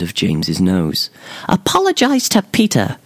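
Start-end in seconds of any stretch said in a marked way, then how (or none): 1.5-3.0 very high, bell-like little fairy voice; high-pitched fairy making a demand; tiny sing-song fairy. A narrator's reading. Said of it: "her tiny voice a shambles of sing-song bell ringing".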